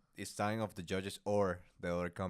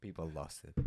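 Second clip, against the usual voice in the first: ordinary delivery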